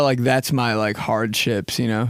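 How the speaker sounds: ordinary talk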